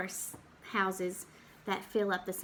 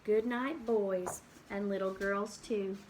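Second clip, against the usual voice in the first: gentle storybook ending; 2 semitones lower than usual. Acted. Closing line of read book.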